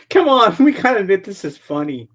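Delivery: ordinary talk, nothing marked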